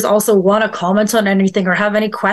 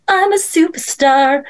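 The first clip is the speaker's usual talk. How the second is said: grandly boastful; 6 semitones higher, slower than usual. Retold or imitated.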